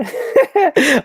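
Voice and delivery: high, quick burst of laughter; amused laugh. Real, not acted. Streamer teased by viewers about looking sad, amused laugh. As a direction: laugh out loud, pitched high.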